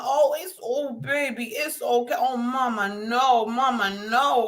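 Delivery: put-on high soothing baby talk to a crying infant; cooing to baby. Mom comforting crying baby, high cooing voice. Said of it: "scoop 'em up".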